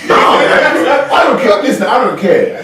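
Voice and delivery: loud, high mock outrage; mock shout. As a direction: yell it like you're fed up. Mock outrage over movie opinion.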